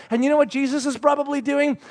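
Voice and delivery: normal talking voice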